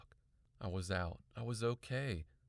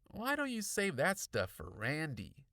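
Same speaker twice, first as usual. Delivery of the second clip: put-on lightly pitched-up kid's suggestion; dismissive boy; 6 semitones higher, 3 dB louder than usual. A narrator's reading. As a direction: brush her off as a boy. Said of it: "Aaron suggested".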